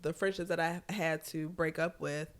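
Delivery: normal talking voice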